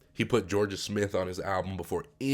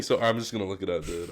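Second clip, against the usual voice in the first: ordinary talk, nothing marked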